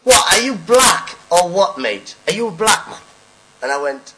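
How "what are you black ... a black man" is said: slow, loud, much higher jeer; imitating the heckler starting up again; mock heckler shout. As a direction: yell it again, more insistent. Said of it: "He goes".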